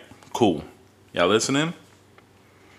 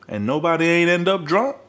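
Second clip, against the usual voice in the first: normal talking voice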